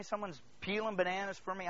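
ordinary talk, nothing marked

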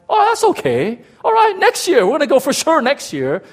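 put-on very high, loud child's reassurance; high-pitched, insistent optimism; overeager kid. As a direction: imitate a kid pumping up fresh hope. Children consoling themselves after letdown.

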